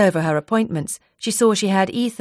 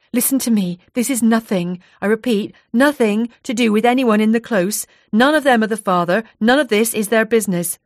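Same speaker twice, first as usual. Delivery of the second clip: firm, raised voice; angry glare; 5 semitones higher, 4 dB louder than usual. Acted. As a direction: Jade laying it down hard, higher and louder. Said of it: "Jade glared at her".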